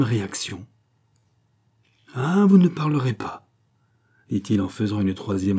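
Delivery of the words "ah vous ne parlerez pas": higher, louder, falling exclamation; forceful challenge. A narrator's reading. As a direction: swell on "vous", then fall in defiance. Gilbert's challenge turns into exclamation.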